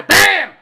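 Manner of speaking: sudden high, loud "BAM"; shouted gunshot "bam". Retold or imitated. Storyteller acting out the shot, explosive shout. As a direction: explode on one word like a gunshot.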